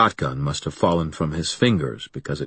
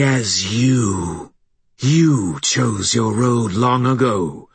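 slow, rasping alien voice, higher; harsh rasp; 4 semitones higher, slower than usual. Acted. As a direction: snarl it out like an alien thing. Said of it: "its alien voice came out in a harsh rasp".

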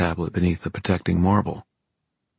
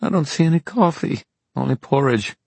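high whiny complaint; whimpering; 10 semitones higher than usual. A narrator's reading. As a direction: whine like a coffee-starved friend. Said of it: "Christina whimpered".

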